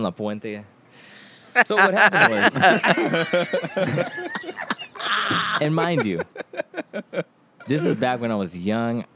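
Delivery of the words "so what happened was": laughing. Real, not acted. Starting the story laughing.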